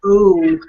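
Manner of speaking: a long round "ooh" for the Wisconsin O. Shown as a demonstration. Host savoring the Wisconsin O she loves, a round drawn-out ooh. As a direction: round the lips into a big "ooh". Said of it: "I just love that Wisconsin O".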